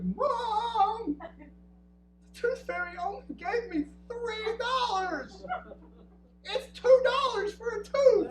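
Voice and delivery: put-on high, loud wailing sob of a little girl; crying child. Daughter sobbing to mom over the tooth fairy, high crying wail. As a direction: wail it out like a cheated kid. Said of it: "she's got these tears in her face".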